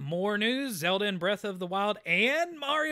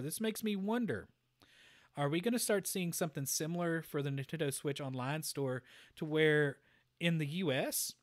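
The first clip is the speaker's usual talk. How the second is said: ordinary talk, nothing marked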